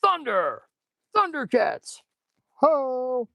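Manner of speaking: high, drawn-out cartoon hero war cry; Lionel battle cry. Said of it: "I'd be like Lionel".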